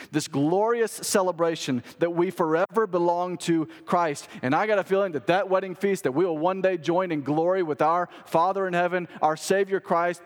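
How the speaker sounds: loud, high, rousing sermon voice; fervent pulpit delivery building to a peak; impassioned preaching. Acted. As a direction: preach it out with full conviction. Preaching at full force.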